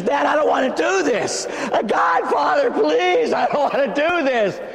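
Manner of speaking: high, shouted desperate plea; begging. Acted. Preacher as Jesus begging his Father, desperate shouted plea. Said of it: "He begs his father".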